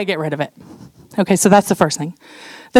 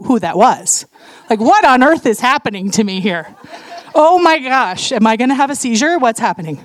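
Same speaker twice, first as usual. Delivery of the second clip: normal talking voice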